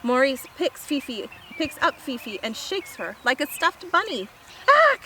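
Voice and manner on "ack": short choked ack; startled yelp. Acted. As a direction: yelp as a grabbed little dog. Fifi shaken by Maurice.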